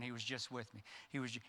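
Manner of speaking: very soft, stunned murmur; dazed murmur in shock. Retold or imitated. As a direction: barely voice it, numb with shock. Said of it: "he's going into shock".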